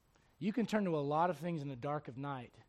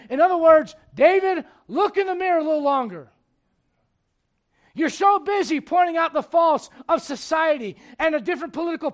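high, very loud sermon voice; full-force preaching; 11 semitones higher, 17 dB louder than usual. Acted. Preacher urging church to look in the mirror, full-force preaching. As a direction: shout the charge at the congregation.

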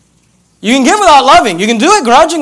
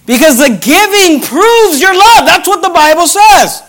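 raised, slow preacher's proclamation; emphatic preaching; 8 semitones higher, 3 dB louder, slower than usual. Real, not acted. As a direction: preach it high and slow, driving each point. Preaches at full force.

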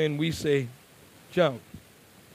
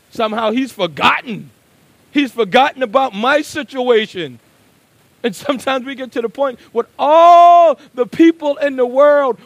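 loud, high, ringing pulpit delivery; preaching at full force; 6 semitones higher, 11 dB louder than usual. Acted. Preacher voicing the psalmist's plea, loud and ringing. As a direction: cry it out to the whole church.

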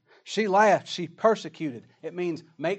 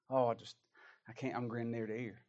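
ordinary delivery

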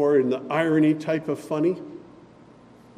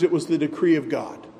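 usual speaking voice

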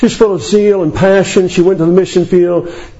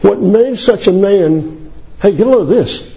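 ordinary talk, nothing marked

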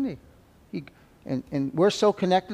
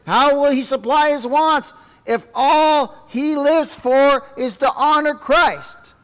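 much higher, louder, slowing indignation; the clerk scoffing at Paul; incredulous clerk; 11 semitones higher, 8 dB louder than usual. Acted. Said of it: "for he said".

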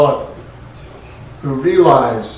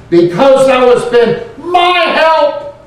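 slow, raised, ringing verse reading; proclaiming scripture; 10 semitones higher, 6 dB louder, slower than usual. Acted. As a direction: lift the verse up high and slow.

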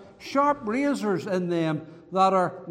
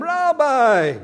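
higher, louder sly Rabbi; feigned respect; 6 semitones higher, 7 dB louder than usual. Retold or imitated. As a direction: say Rabbi with fake sweetness. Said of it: "pretending to say something innocently to him".

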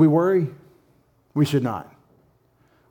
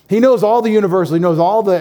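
higher, louder, faster, hammering the phrase home; emphatic repeat; 7 semitones higher, 7 dB louder, faster than usual. Shown as a demonstration. Pastor driving the point home to the congregation, loud and fast. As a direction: declare it like a proclamation.

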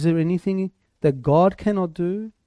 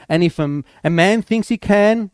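ordinary talk, nothing marked

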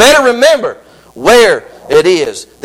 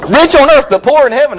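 higher and louder, punching the contrast; preaching emphasis; 6 semitones higher, 5 dB louder than usual. Acted. Preacher hammering the rich fool's lesson, loud. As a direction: proclaim it to the church.

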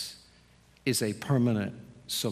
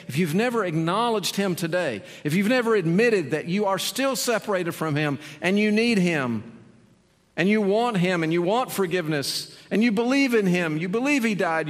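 higher and louder, building preacher's appeal; full-force altar call; 6 semitones higher, 5 dB louder, faster than usual. Acted.

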